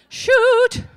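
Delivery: high, loud, sharp Shoot!; mother's mock swear. Mother shrieking Shoot! after cutting her hand. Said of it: "That would be my mother".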